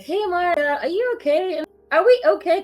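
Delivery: concerned friend. Retold or imitated. The colleague texting to check in, mock concerned. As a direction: lightly voice a worried friend checking in. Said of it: "pinged me, like".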